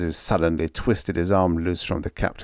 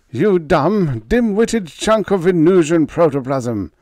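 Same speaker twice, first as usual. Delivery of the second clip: loud, much higher furious voice; snarling; 10 semitones higher, 7 dB louder than usual. A narrator's reading. Scientist beating his slave with a cane, furious loud snarl. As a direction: spit the insult as you strike. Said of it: "Renzo snarled".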